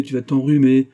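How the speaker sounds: loud, high parroted scolding; merry mockery. A narrator's reading. Antonine mimicking mother's fussing about colds, merry mockery. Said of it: "dit Antonine en riant".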